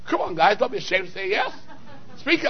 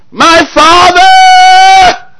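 high, drawn-out cry, much louder; anguished cry; 6 dB louder, much slower than usual. Acted. Jesus calling out to his Father in a long, high, loud wail.

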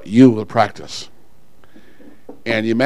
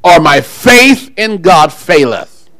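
loud, higher-pitched preacher's cry; full-force preaching; 6 semitones higher, 6 dB louder than usual. Real, not acted. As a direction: fire up the congregation with a ringing voice.

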